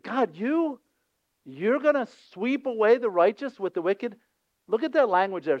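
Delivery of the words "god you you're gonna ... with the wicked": high, pleading cry to God; pleading Abraham. Acted. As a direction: beg God, voice climbing high.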